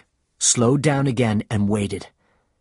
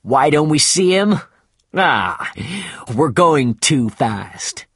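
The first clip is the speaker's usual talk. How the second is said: loud and urgent, slowing at the end; a captain calling out from the sails, louder; raised captain's voice; 4 semitones higher, 4 dB louder, slower than usual. Acted. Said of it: "he said".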